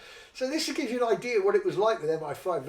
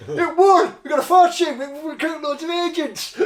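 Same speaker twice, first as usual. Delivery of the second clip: much higher and louder, triumphant boast; loud gloating; 10 semitones higher, 11 dB louder than usual. Retold or imitated. Officers mocking the public school boy, loud and gloating. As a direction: shout it out like you're rubbing it in.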